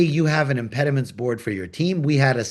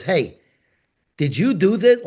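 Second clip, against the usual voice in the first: imitating an angry coworker, raised and louder; 6 semitones higher, 5 dB louder than usual. Coworker confronting him in the hallway, angry tone. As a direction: challenge him like a coworker caught off guard. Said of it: "like, an angry tone".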